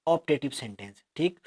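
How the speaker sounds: ordinary talk, nothing marked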